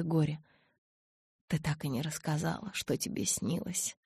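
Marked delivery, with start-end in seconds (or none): 1.5-4.0 hushed. Acted. Edward quietly asking Bella about her dream, hushed whisper. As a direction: ask it close and low, almost unvoiced.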